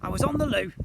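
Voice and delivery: high, loud called excuse; calling out. A narrator's reading. Slime explaining lateness, high loud call. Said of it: "Slime called out".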